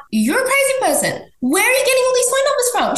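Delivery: high, fast disbelieving outburst; shrill mock outrage at the mistress; incredulous. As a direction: shriek the question in disbelief. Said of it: "I'm like".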